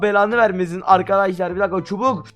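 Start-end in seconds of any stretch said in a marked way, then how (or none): none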